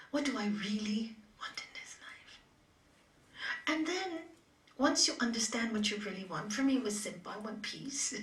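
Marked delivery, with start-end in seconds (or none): 0.1-2.4 a hushed inner question; breathy self-questioning whisper. Shown as a demonstration. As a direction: whisper it to yourself, unvoiced.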